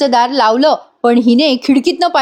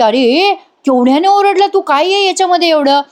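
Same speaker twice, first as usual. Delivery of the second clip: scolding shout; 6 semitones higher than usual. Retold or imitated. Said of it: "मानिनी ओरडते".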